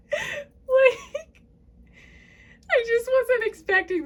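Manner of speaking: one word squeezed out high through laughter; high, loud laughing squeal; can't stop laughing. Real, not acted. Reader caught off guard by the reading, helpless laughter. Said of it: "I can't stop laughing".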